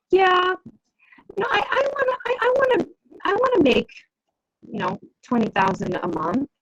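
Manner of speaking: high, hesitant and halting, as if doubting the goal; unsure, questioning. Shown as a demonstration. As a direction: say it like you're not sure you can do it. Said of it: "The inflection in my voice made it seem like I was questioning".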